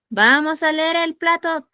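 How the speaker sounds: teacher teasing the class with a wrong option; mock incredulous question. Shown as a demonstration. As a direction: drag it out as a silly suggestion. Teacher mocks an absurd answer.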